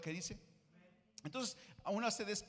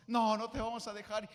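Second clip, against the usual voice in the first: raised, louder pleading promise; louder mock-tearful vow; emotional promise; 5 semitones higher, 6 dB louder than usual. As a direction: cry it out like a vow through tears. Quoting the women's tearful promise.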